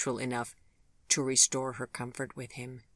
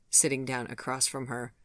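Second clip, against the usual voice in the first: ordinary delivery, nothing marked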